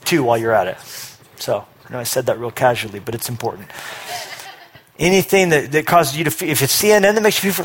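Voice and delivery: ordinary talk, nothing marked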